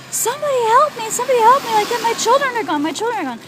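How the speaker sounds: imitated high, desperate cry for help; retold screaming. Acting out her sister begging for help, high retold screaming. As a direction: wail it high like a mother in agony. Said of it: "Like that".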